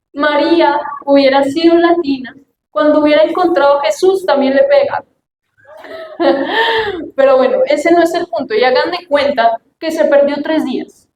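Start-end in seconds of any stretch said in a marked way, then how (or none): 5.9-7.1 bright high burst of laughter; a short high giggle falling away. Real, not acted. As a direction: laugh at your own joke. Laughs at her own joke.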